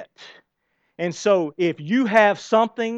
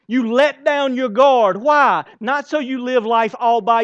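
slow, loud, high proclamation; raised preaching voice; emphatic preaching; 6 semitones higher, 6 dB louder, slower than usual. Real, not acted. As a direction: drive it home slowly and strongly. Preacher declaring loudly.

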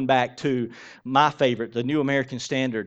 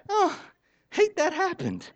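much higher, airy fake pity; mock sympathy. Pastor mimicking a parent's hollow sympathy for another's child, very high and fake. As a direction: coo a hollow "oh" like you don't care. Mocking parent's indifference, continued.

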